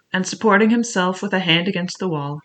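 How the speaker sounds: plain reading voice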